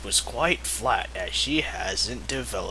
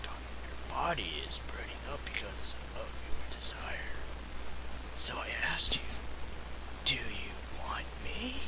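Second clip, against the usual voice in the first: much softer, whispered come-on; seductive whisper; 3 semitones lower, 14 dB quieter, faster than usual. Acted. Wolf asks Mari if she wants him, whispered. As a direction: whisper it close and low. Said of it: "Wolf asked her".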